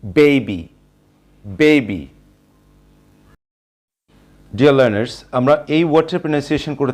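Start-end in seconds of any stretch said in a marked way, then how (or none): 0.0-2.1 baby with BAY stressed and the ay long; baby punched on the first syllable; teacher modelling baby with a stressed first syllable. Shown as a demonstration. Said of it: "এটার pronunciation হবে baby".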